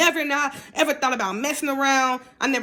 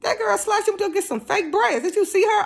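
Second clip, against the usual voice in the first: much higher, excited daughter; 10 semitones higher than usual. Retold or imitated. Daughter telling mom about Karina, much higher excited gossip. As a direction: play the teenage daughter, thrilled with gossip. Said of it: "it took my daughter like".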